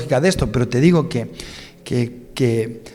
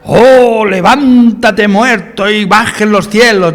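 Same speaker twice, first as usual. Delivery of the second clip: higher, louder, slow pompous proclamation; mock grand prophecy; 6 semitones higher, 8 dB louder, much slower than usual. Pastor mocking a showy prophetic shout, hammed-up and slow. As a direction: ham up a booming prophetic cry. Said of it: "¿Dice eso?".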